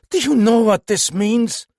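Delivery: trembling captain bursts out in alarm; crying out. Acted. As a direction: cry out in shock. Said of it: "cried Chaumont".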